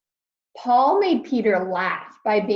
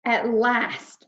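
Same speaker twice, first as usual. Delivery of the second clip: ordinary delivery, nothing marked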